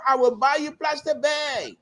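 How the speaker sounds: high-pitched, called-out campaign slogan; campaigning politician. Acted. Quoting a politician's campaign song, high and called out. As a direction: mimic a politician's crowd-pleasing promise. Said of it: "You remember that song?".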